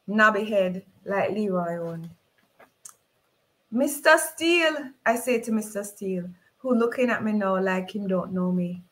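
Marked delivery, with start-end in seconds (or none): 3.7-4.9 pleading appeal. Acted. Mother appeals to Mister Steele.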